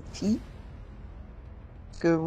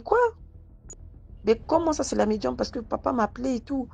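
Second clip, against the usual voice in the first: higher, startled reported question; retold disbelief; 6 semitones higher than usual. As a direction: voice the brother's shock lightly. The brother can't believe it's the medium. (le petit frère, incrédule, surpris)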